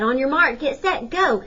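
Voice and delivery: rising, loud starting call; race starter. A narrator's reading.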